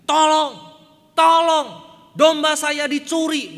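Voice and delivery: very loud, high, slowed cry for help; shouting for help. Retold or imitated. The bored shepherd fakes a theft to the villagers, loud high shout. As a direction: yell it across the field like a panicked shepherd. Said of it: "berteriak demikian".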